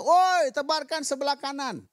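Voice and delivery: high, loud, slow call across the water; Jesus calling loudly to the boat, high and slow; calling from afar. Acted. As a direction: shout it out to fishermen far off. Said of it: "Yesus bilang dari jauh".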